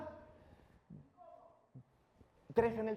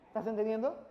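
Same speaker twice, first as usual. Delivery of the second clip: usual speaking voice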